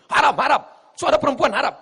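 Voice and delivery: high, hurried outcry; mock zealot objection. Preacher mimics someone banning women's voices, mock high protest. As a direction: cry out the ban in a high voice.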